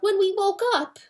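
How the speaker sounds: high, anxious little mouse voices; frightened mice. A narrator's reading. Terrified mice begging the hen for news, high and anxious. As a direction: squeak it out like scared mice.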